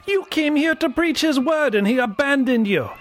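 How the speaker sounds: high, strained angry accusation; furious outburst. A narrator's reading. Captive accusing the missionary of being forsaken, furious. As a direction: hurl the blame with pitch held high. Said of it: "Lerwick snapped".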